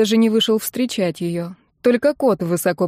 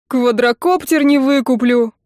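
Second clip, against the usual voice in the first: loud, higher whine of loss; anguished howl; 4 semitones higher, 5 dB louder than usual. A narrator's reading. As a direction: wail over the lost toy. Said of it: "с непередаваемой тоской в голосе взвыл Сергей". (воет с тоской)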